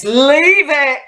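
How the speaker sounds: high-pitched furious command; sudden cry, pitch leaping right up; angry outburst. Acted. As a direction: burst out as the blood rushes to the face. Blood rushing, snaps as phone rings.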